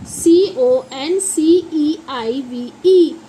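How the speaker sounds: slow letter-by-letter spelling; measured classroom spelling. Shown as a demonstration. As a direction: spell it out one drawn letter at a time. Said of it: "इसे हम बोलते हैं".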